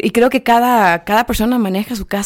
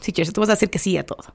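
sarcastic whisper; 7 dB quieter, faster than usual. Real, not acted. Sarcastic aside to Chelsea.

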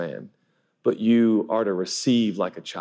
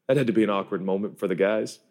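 ordinary talk, nothing marked

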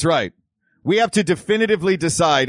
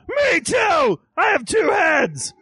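high, shouted cartoon devil; Double Devil voice; 13 semitones higher, much slower than usual. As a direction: squeal it like a tiny two-headed devil. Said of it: "I also play Double Devil".

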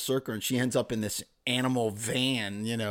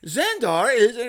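mock character voice; 10 semitones higher, 6 dB louder, slower than usual. Quoting character in story.

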